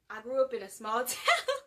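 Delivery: loud retry breaking into a laughing wail; exasperated yelp at the end; frustrated retry. Real, not acted. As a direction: try the line, then crack up in frustration. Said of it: "I'm getting frustrated with myself".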